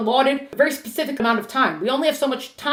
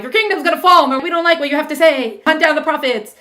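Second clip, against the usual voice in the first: raised voice; 6 semitones higher, 6 dB louder than usual. Retold or imitated. Acted threats between prophet and king.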